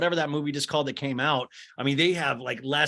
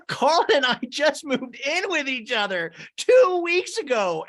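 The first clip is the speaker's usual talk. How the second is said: high, loud, stretched-out gushing; hammed-up squealing excitement; Lindsay, very amped up; 12 semitones higher, 5 dB louder, slower than usual. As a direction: imitate an over-excited girlfriend. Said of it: "She's very amped up".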